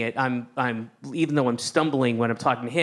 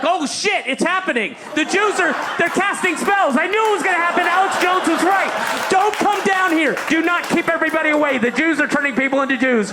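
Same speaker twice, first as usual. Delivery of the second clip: high, loud, hammed-up alarm; panicked shouting; 6 dB louder than usual. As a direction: shout a frantic warning to everyone. Acting out panicked onlookers.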